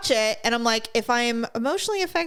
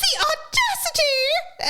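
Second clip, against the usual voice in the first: laughing outrage; much slower than usual. Real, not acted. Outraged retelling.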